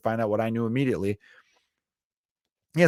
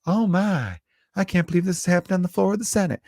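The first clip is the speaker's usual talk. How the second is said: higher, fussy Southern senator; Lindsey Graham impression; 8 semitones higher than usual. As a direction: clutch pearls like a flustered senator. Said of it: "a very Lindsey Graham's type of thing".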